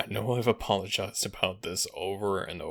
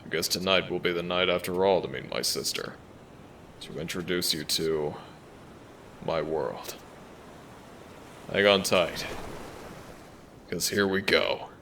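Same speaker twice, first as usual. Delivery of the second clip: dragon giving in. Acted. Dragon agrees to take companion along.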